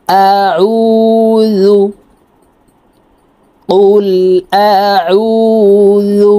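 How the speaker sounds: আউযু drawn out with the ain pressed from the mid throat. Shown as a demonstration. Teacher drilling the throat letter ain. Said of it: "আইন হরফ হলকের মধ্যখান থেকে চাপ দিয়ে".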